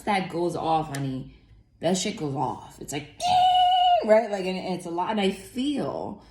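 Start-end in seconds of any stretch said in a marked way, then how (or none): 3.2-4.1 high ringing ding like an alarm. Retold or imitated. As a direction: ring out like an alarm clock. Mimicking alarm clock ring.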